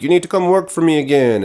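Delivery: high, insistent mock villain demand; imitating a bossy captor, no accent; villain's demand. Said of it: "and she's like".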